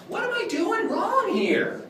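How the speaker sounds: high, slow, stretched-out lament; mock exasperation. Clown blaming himself before kids, mock exasperated. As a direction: wail it slowly in clownish frustration. Clown feigns frustration at broken prop.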